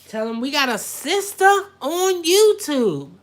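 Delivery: loud, high hype call; excited. Real, not acted. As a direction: call it out, hyped up. Hypes viewers, then laughs.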